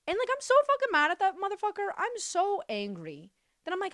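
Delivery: high-pitched, fast angry outburst; real anger. Real, not acted. Venting about stepdad's death, really angry. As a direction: let the anger spill out high and quick.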